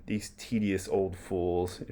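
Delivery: put-on slow, disdainful muttered aside about the old man; scornful aside. Hamlet dismissing Polonius as he leaves, slow scornful aside. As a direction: sneer it out under your breath, slowly. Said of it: "referencing Polonius there".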